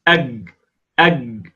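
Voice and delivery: أج with a hard g stop; kaf-jim demo; kaf-jim sound. Shown as a demonstration. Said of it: "بين مخرج الجيم والكاف".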